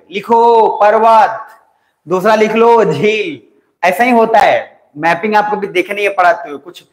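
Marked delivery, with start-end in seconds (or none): 0.1-3.4 slow, stretched-out dictation in mock style; rote-learning teacher. Teacher mimicking rote map lessons to class, drawn-out mock dictation. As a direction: drag out each item for rote copying. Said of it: "आपको रटवा देते".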